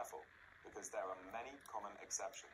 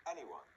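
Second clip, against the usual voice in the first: AN-yone, stressed at the beginning; first-syllable stress. Shown as a demonstration. Teacher modelling a word to repeat, first syllable stressed in Anyone. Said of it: "It can be at the beginning".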